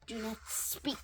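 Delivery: hushed tragic whisper. Acted. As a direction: whisper it sorrowfully. Tragic hush.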